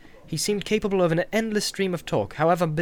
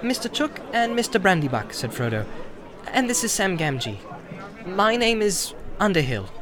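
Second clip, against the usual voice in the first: polite hobbit; 4 semitones higher than usual. Acted. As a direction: ask politely but clearly. Frodo introduces companions.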